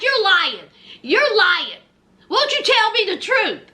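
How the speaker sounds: high, insistent mock accusing; imitated accusation. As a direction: press them for the truth, acting out the quarrel. Retold accusation in a quarrel.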